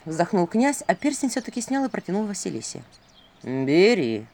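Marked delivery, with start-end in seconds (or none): 3.4-4.3 low, pressing stage prompt; owl's sotto voce urging; prompter's aside. A narrator's reading. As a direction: prompt her in a lowered voice. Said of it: "суфлёрски произнёс Филин".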